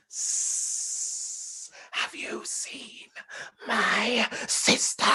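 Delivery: slow, whispered serpent with a hiss at the start; hissing snake. Acted. Huge snake asking the princess about his sister, slow hiss. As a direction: creep the words out like a big snake.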